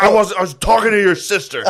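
high, agitated imitation of an angry dad; mock-flustered, angry excuse; angry deflection. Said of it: "while angry, he had to deflect".

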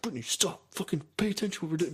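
hushed angry scolding. Retold or imitated. Retold scolding of girlfriend.

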